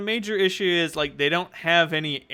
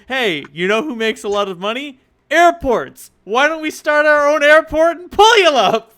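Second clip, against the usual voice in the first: shouting, much higher and louder; excited; 11 semitones higher, 10 dB louder than usual. Retold or imitated. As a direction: hype it up like a wild pitch. Gangers voiced pitching airport idea.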